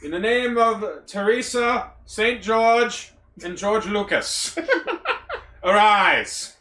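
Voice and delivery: hammed-up royal dubbing; solemn knighting ceremony.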